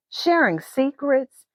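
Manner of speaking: usual speaking voice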